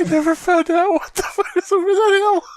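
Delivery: high fanboy whine, half laughing; mock outrage. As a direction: lament it as the cheated fan. Acting the cheated fan.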